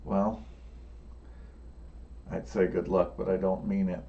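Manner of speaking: lightly voiced villain, low and quieting; understated villain's parting remark; defeated demon. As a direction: drop it low for a grudging farewell. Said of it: "And he goes".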